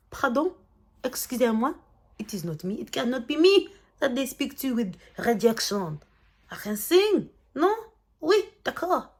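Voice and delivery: outraged mock French accent. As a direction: play an offended French diva in a French accent. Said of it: "I do a great French accent".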